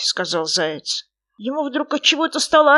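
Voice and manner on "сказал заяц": ordinary delivery, nothing marked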